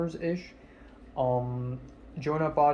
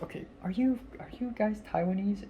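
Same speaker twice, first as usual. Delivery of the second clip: higher, hesitant asking; tentative question; 6 semitones higher, 4 dB quieter than usual. Retold or imitated. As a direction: retell the nervous question, pitched up. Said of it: "I'm like".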